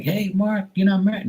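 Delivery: high, prying mock voice; high mock voice of people quizzing Mark; mocking curious people. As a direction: ask it like a nosy acquaintance. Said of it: "now they're like".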